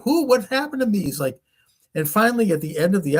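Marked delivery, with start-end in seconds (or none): 0.0-1.0 high-pitched mock bewilderment; retold panicky cry, pitched up without shouting; imitated panic. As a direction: act out a man running around lost. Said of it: "it's so frightening that then he's, like, running around".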